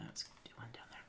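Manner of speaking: quiet focused whisper. Real, not acted. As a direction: murmur to yourself. Whispering while editing.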